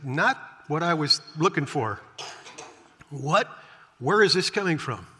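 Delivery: baffled, rising puzzled questions; totally confused. Retold or imitated. Nicodemus reacting to Jesus, baffled and confused. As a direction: Nicodemus caught off guard, confused. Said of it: "Totally confused".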